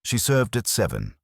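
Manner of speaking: ordinary delivery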